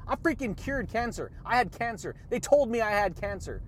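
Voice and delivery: usual speaking voice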